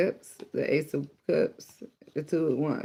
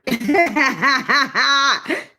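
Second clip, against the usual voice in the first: high, loud burst of cackling; loud laughing fit; 10 semitones higher, 7 dB louder than usual. Real, not acted. Reader cracking up at calling someone coward, real loud laugh. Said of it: "I shouldn't laugh".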